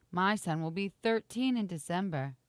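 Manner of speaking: normal talking voice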